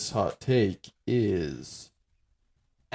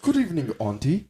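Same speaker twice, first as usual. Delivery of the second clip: lightly posh British greeting, slightly higher; a playful British gentleman; light British butler impression; mock British; 6 semitones higher than usual. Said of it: "like a British man".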